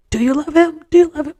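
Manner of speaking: high, loud nagging questions; pestering. Retold or imitated. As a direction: badger her over and over. Friends pestering repeatedly.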